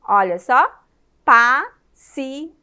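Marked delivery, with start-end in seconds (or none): 1.2-2.5 "Paciência" loud, with long gaps: pa, ci, ên, cia; syllable by syllable. Shown as a demonstration. Teacher counting mouth openings for children, loud separated syllables. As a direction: count out "Paciência" slowly, one syllable at a time. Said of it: "Quantas vezes você abre a boca".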